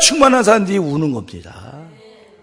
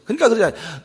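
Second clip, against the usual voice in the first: usual speaking voice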